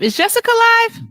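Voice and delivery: high, loud, eager question; excited asking. Real, not acted. Host asking if her favourite is live, excited.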